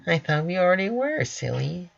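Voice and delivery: high, fast bubbly Pinkie voice. Acted. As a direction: chirp it like it's obvious. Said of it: "she replies".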